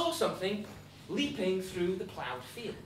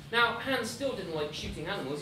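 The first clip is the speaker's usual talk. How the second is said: ordinary delivery, nothing marked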